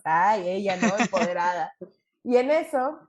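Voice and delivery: ordinary talk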